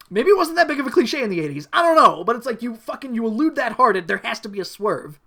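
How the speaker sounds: loud, high exasperated rant; worked-up indignation; angry rant. Real, not acted. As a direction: argue heatedly, fed up with the plot. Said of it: "I was very angry".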